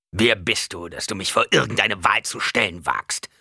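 put-on loud, indignant old tyrant; old Baron. A narrator's reading. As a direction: demand it like an affronted old nobleman. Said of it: "mit der Stimme des alten Barons". (empörte Stimme eines alten Barons)

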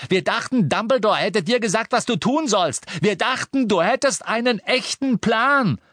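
high, loud furious shout. A narrator's reading. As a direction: yell it at him, dragging the last word. Ron keeps shouting.

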